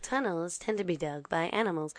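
hushed nature documentary read; documentary narrator. Pretend. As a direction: narrate like a wildlife film. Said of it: "like, go".

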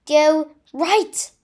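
high, loud warning call. A narrator's reading. Ron warning friends of a curse, calling out. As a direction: call a direction urgently. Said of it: "called Ron".

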